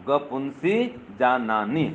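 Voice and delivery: slow, drawn-out syllable by syllable; slow mnemonic recitation. Shown as a demonstration. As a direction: recite it slowly for students to memorise. Mnemonic repeated for students to memorise.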